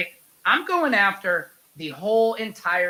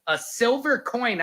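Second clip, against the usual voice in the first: ordinary talk, nothing marked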